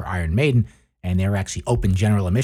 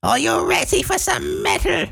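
much higher, nasal metal-singer voice, calling out to a crowd; slower than usual. Retold or imitated. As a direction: ham up a nasal, high-pitched frontman working the crowd. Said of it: "He has this sort of nasally, high-pitched voice like".

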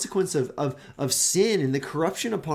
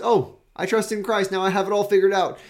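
high, louder, breezy and fast; smug believer; 7 semitones higher, 7 dB louder than usual. Retold or imitated. Preacher mimics a believer who thinks faith is settled, breezy smugness. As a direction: toss it off like you've got faith all sewn up.

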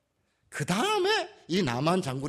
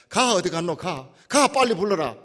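Gyeongsang dialect call; 2 semitones higher, 7 dB louder than usual. Retold or imitated. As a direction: order them in Gyeongsang dialect to fetch her. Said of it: "우리 경상도 말로 치면은".

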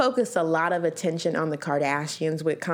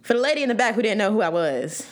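ordinary talk

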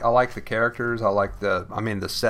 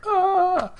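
mock exasperated squeal. Exasperated reaction to show.

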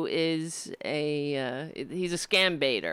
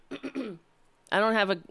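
talking around a sip of coffee; while sipping a drink; 5 semitones higher, slower than usual. Real, not acted. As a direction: speak just after sipping a drink. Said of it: "Excuse me while I drink coffee in your ear".

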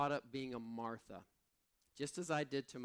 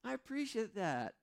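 preen like someone receiving praise; hammed-up high flattered thanks; mock flattered; 10 semitones higher than usual. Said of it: "I'm like".

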